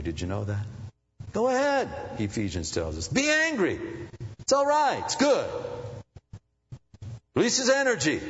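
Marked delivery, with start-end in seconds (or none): 1.3-8.2 preaching burst. Acted. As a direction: shout the encouragement.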